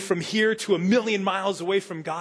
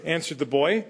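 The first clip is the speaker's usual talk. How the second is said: ordinary talk, nothing marked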